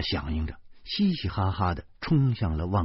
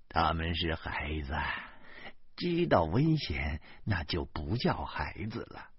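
soft, low resigned sigh of a reply; a tired adult shrugging it off; 无奈地说; helpless; 5 semitones lower, 8 dB quieter than usual. Acted. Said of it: "中年人无奈地说".

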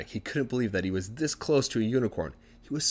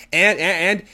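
high, loud, stammered build-up; eager tattling; 9 semitones higher, 10 dB louder, slower than usual. Acted. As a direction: stumble over the word, bursting to add more. Wife eagerly piles on evidence.

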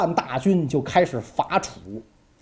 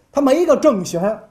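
ordinary talk, nothing marked

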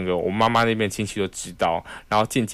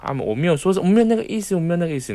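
high, hurried backpedaling; mock flustered uncle; flustered denial; 9 semitones higher, much faster than usual. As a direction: uncle scrambling to take it back.

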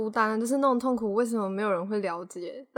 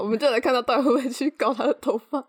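ordinary delivery

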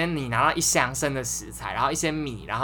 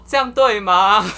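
sneering challenge; 6 semitones higher, 9 dB louder, much slower than usual. Retold or imitated. Senior scolding each step.

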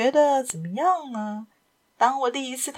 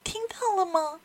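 anxious, higher and softer question; uneasy; 9 semitones higher, 5 dB quieter than usual. Acted. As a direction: nervously ask if they heard it. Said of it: "神情不安地".

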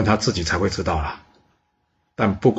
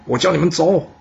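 shouting; 8 semitones higher than usual. Acted. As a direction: yell at them to leave, really pushing it. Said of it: "他再次大声的喊说".

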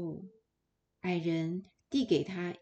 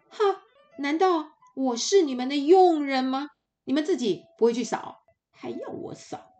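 much louder, fast, scornful outrage; indignant snap; 7 semitones higher, 9 dB louder than usual. Acted. Lazy girl refuses to sweep for the dwarves, indignant. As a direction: snap back as if insulted. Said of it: "这个女孩马上板起脸来".

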